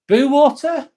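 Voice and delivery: normal talking voice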